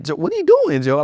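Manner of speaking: a dad who can't believe what he's finding; high, incredulous what are you doing; baffled dad. Retold or imitated. Dad baffled while brushing teeth.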